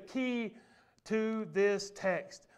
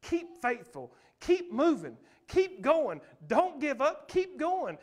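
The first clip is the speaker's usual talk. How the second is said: rising, louder exhortation; preaching at full force; 4 semitones higher, 3 dB louder, slower than usual. Acted. Pastor urging the congregation to keep going, full preaching. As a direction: urge the crowd on, pushing harder each line.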